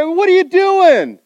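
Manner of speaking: high loud slow what are you doing; exasperated plea. Acted. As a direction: beg him to stop, exasperated. Friend confronting Pat.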